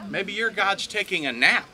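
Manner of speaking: mock-innocent suggestion. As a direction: taunt them, lifting the last word. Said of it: "He starts to mock them".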